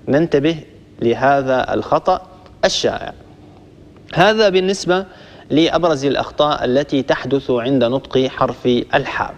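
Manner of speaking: ordinary talk, nothing marked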